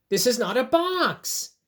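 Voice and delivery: high, loud, exasperated protest; frustrated storybook insistence. Acted. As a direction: protest it as a fed-up bunny. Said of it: "I wonder if he's still feeling frustrated".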